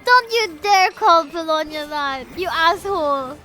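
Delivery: ordinary talk, nothing marked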